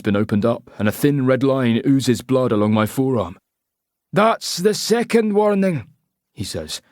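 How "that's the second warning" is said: slow, high Scottish menace; threatening Scottish accent. A narrator's reading. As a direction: warn him coldly in Scottish. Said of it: "he says in a Scottish accent".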